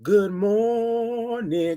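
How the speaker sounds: very slow, drawn-out good morning; stretched greeting. Shown as a demonstration. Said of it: "You gotta say it like that".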